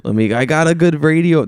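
hammed-up mom, high and loud; bad mom imitation. Guest voicing his mom pleading to come on, poorly imitated. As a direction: put on a pushy mom voice, badly. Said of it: "That's not how my mom sounds at all".

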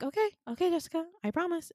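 high, quick giving-in; placating promise. Retold or imitated. Patient pressed by nurse to behave, quick placating promise.

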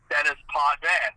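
Dennis Potvin said English, DEN-iss POT-vin; Americanized pronunciation. Shown as a demonstration. Explaining how the name got Americanized, Dennis Potvin in English pronunciation. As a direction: sound the s in Dennis and the final n in Potvin. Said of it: "the name got Americanized".

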